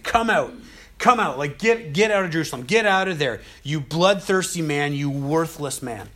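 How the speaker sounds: a man on a hill cursing the fleeing king, angry. Retold or imitated. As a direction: hurl insults down at the king. Shimei cursing David from a hill.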